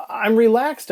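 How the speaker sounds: high, loud, anxious insistence; agitated protest. Acted. Anxious patient protests to paramedics.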